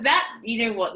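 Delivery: ordinary delivery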